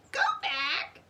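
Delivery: light squawk; parrot squawk. A narrator's reading. Polly warns again, mild squawk. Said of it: "squawked Polly".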